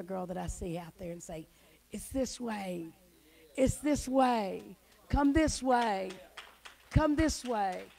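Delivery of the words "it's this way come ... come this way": loud, slow, higher call. Retold or imitated. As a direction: call her home across a distance. Calling a lost girl.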